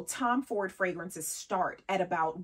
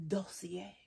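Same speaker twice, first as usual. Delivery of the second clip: Dossier said the French way, dos-see-ay, soft and rising; fancy French accent; 8 dB quieter than usual. Shown as a demonstration. Vlogger showing how a French accent makes it fancy, lightly accented. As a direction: purr Dossier like a chic Parisian. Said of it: "with a French accent, it becomes fancy".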